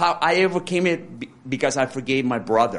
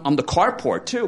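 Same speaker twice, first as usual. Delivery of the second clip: ordinary talk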